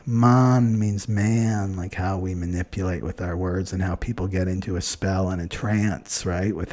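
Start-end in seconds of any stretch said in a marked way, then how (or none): 0.1-1.8 Man and man said very slowly and deliberately; stress on Man. Shown as a demonstration. Explaining the word manipulate, she slowly stresses Man.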